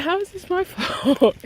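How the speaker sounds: high-pitched incredulous protest with a laugh; indignant retort. Retold or imitated. Retelling her retort in an argument.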